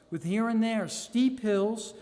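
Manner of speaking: plain reading voice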